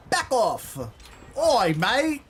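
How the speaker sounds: loud, high-pitched mock British yell; British accent. Gamer warding off the killer as Lara Croft, loud British accent. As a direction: shout it in a hammed-up British accent. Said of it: "Not the British accent again".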